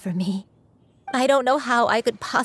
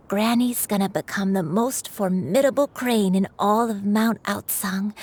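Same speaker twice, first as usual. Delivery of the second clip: ordinary talk, nothing marked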